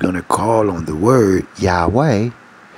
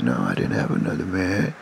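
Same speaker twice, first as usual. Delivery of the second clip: retold denial; 8 semitones lower, 7 dB quieter than usual. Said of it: "It's like".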